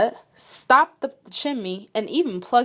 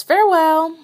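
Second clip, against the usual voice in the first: chirpy farewell; 7 semitones higher, 6 dB louder than usual. Acted. Mouse bids farewell.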